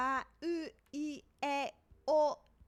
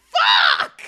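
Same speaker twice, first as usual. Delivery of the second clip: loud swear dropping low; real angry outburst; frustrated shout; 14 dB louder than usual. Real, not acted. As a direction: bark it out in frustration. Fails word again.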